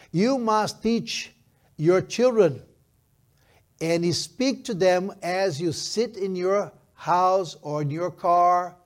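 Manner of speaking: slow, emphatic verse reading; scripture declamation. Acted. Pastor reading how to teach children, slow emphatic declamation. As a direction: proclaim it in measured, punched phrases.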